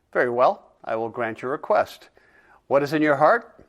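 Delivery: plain reading voice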